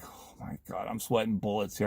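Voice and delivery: very low, hushed muttering; mock-nervous, under the breath; nervous inner aside. Retold nervous thought to himself.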